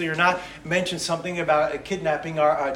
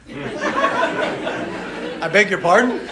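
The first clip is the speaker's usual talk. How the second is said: puzzled; 4 semitones higher, 3 dB louder, much slower than usual. Retold or imitated. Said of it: "Somebody said".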